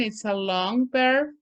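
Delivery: ordinary talk, nothing marked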